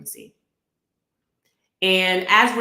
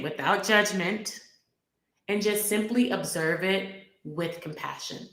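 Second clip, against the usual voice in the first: guided meditation. Acted. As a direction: guide them gently into the heart.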